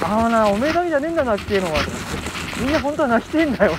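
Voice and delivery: mock old man lecture. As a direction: lecture her like a rough uncle. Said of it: "五十歳ぐらいのおっさんが来て".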